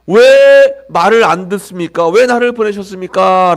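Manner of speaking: 크고 느리게 왜를 내리꽂는 항의; 답답함을 터뜨리며 하나님께 따지기; 따지는 항의. Acted. 모세의 항의를 재현. (모세가 하나님께 크게 따지는 항의)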